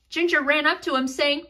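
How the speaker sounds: plain reading voice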